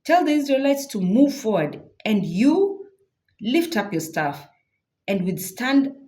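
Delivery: a hint of a commanding God. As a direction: order them forward like a firm God. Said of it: "God continues".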